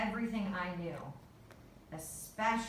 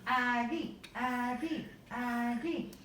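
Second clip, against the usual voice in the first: mild narrated scream, higher-pitched chant; dad's excited chant, short of screaming; screamed cheer; 4 semitones higher, slower than usual. A narrator's reading. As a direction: cheer "Aggie" in rhythm, voice lifted. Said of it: "Dad screamed".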